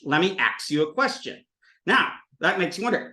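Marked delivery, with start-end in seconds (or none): 0.0-1.4 "ask" said with K before S, "aks". Shown as a demonstration. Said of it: "S K can become a ksa sound".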